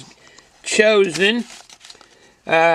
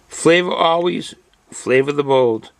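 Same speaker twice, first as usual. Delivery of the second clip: punchy motto, announcer style; bold slogan read; 3 semitones lower, slower than usual. Acted. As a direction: proclaim it like a battle cry. Slogan ending the label reading.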